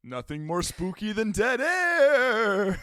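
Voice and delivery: high, loud, slow ghoulish proclamation; hammed-up spooky voice. Co-host filling dead air, hammed-up spooky delivery. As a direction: swell into a campy spooky wail. Joking spooky line after silence.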